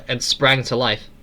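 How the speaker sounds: ordinary delivery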